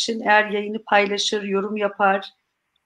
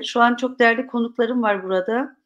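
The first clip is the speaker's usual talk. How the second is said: ordinary talk, nothing marked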